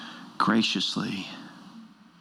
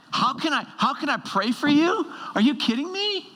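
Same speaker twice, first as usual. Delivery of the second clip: mock disbelief; 10 semitones higher than usual.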